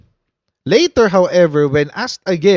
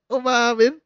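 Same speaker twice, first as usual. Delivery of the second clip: laughing; 5 semitones higher than usual. Real, not acted. Vlogger laughing that the politician admitted it, laughing. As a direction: say it through a laugh.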